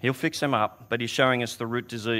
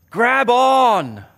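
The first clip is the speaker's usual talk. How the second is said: loud, higher grab on; urgent call; 8 semitones higher, 7 dB louder than usual. Retold or imitated. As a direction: call it over the waves. Reaching out in rip.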